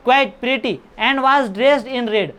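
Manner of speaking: slow, careful English reading; slow reading aloud. Shown as a demonstration. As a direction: read each word out slowly for the class. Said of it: "reading the text aloud".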